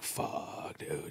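breathless whispered "fuck, dude"; whispering in awe at the sea lions; whispered curse. Retold or imitated. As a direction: hush it like you're scared of the sea lions. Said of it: "and I'm like".

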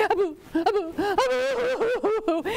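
high hammed-up boo-hoo wail; mock crying. Refused snake cries for the girl, high mock bawling. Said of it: "And he cried and he cried".

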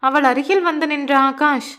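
ordinary delivery, nothing marked

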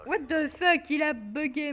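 sudden high, loud exclamation; startled outburst. Real, not acted. Gamer thinks his game froze, startled loud outburst. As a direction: blurt it out, caught off guard. Game seems to bug mid-play.

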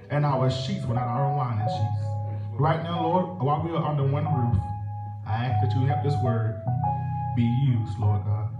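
ordinary talk

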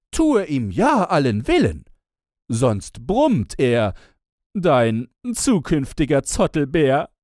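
high, loud sing-song teasing; other mocking sister. A narrator's reading. Second sister taunting the young bride, high and mocking. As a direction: tease sweetly and loudly up high. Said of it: "Diese spöttelten".

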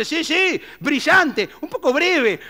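a hint of a show-off neighbour; eager bystander.

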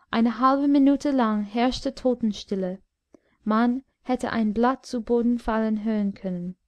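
plain reading voice

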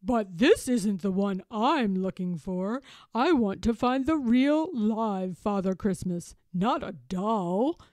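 understated disappointment; lightly voiced let-down Babar; mildly sad. A narrator's reading. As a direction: protest with a touch of sadness. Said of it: "says Babar sadly".

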